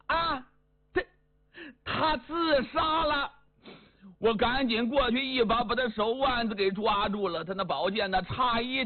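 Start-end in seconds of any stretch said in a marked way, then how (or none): 0.1-3.3 shocked cry. Acted. Yumei shocked, thinks he died.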